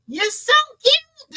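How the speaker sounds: very high, slow, cooing; sweet Southern compliment. Retold or imitated. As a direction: coo it like a doting auntie. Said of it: "people tell".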